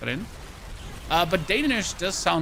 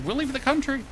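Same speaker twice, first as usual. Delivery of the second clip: defiant shrug; 6 semitones higher, 3 dB quieter than usual. Retold or imitated.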